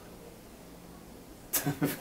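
high soft awkward giggle; nervous laugh. Acted. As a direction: laugh away the embarrassment. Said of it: "I'm so sorry that I'm so awkward".

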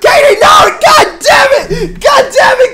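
frantic real yelling at a teammate; panicked shouts, high and loud; shouting. Real, not acted. Teammate dying in game.

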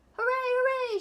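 very high, loud cheer; cheering shout. Acted. Teacher shouting hooray to the principal. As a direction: cheer like you just won. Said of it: "she shouted".

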